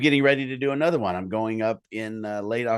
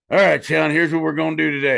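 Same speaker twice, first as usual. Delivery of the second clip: louder, brisk director impression; hurried, take-charge mock director; imitating a director; 4 semitones higher, 8 dB louder, faster than usual. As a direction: lightly mimic a director giving the day's plan. Acting out Patrick directing him.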